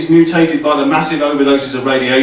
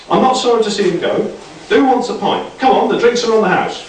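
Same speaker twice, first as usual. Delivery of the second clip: higher, fast breezy chat; lightly voiced cheerful Shah; 10 semitones higher, faster than usual. A narrator's reading. Shah to the survivors, breezy higher voice. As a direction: toss it off brightly.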